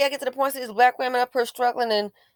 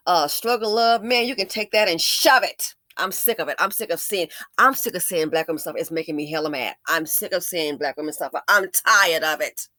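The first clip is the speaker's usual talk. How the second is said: ordinary delivery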